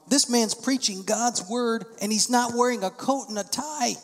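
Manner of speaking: grumbling churchgoer, hammed-up outrage; mock indignant churchgoer. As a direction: caricature a shocked church member. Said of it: "people were indignant".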